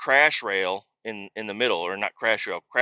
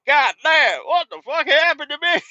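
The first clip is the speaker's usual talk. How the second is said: high, louder hammed-up bewilderment; joking around; 6 dB louder than usual. Driver clowning to make the UPS guy laugh, hammed-up and high. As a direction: play it up to get a laugh. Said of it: "kinda trying to make the guy laugh almost".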